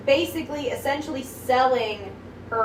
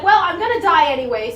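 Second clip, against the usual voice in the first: louder, higher mock defiance; angry defiance; 5 semitones higher, 7 dB louder than usual. Retelling the angry heroine speaking up, mock-defiant. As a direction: retell her throwing caution away. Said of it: "she gets very angry".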